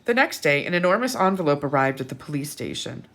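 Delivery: plain reading voice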